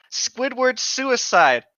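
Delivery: ordinary talk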